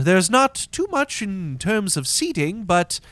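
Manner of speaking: high, loud, fussily polite host; apologetic inn greeter. Acted. Inn host short of seating, politely apologetic. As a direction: apologise effusively to the guests. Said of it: "bows to you and says".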